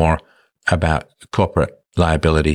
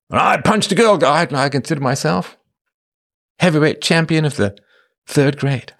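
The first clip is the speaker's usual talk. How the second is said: high-pitched, puffed-up mocking voice; mock boaster; 9 semitones higher than usual. Host mocking a man proud of sparring, boastful voice. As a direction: brag in a silly raised voice.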